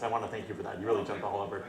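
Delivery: ordinary talk, nothing marked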